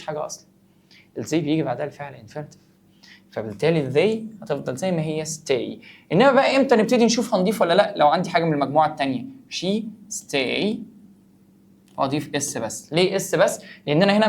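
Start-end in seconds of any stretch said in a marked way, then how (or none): none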